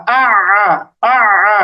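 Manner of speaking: আ squeezed from the middle of the throat; doubled Arabic ayn on আ. Shown as a demonstration. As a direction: draw আ out through a tightened throat. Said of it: "আইনের উচ্চারণটা গলার মাঝখানে নিতে হবে".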